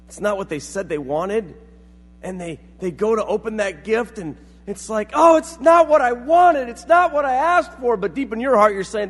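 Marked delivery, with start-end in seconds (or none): 5.1-8.0 loud, high mock whine of protest; disappointed complaint. Gift receiver to giver, loud high mock complaint. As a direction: complain loudly over the wrong present.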